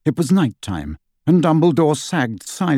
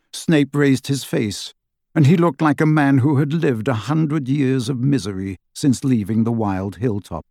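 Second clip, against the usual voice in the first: plain reading voice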